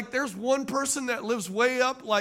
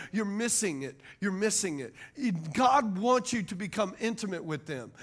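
usual speaking voice

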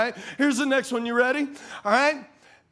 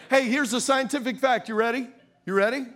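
normal talking voice

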